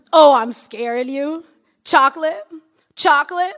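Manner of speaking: loud, high, leering catcaller voice; hollering pickup lines at a passing woman; street catcaller. Acted. A loud, high catcaller shouting at a woman on the street.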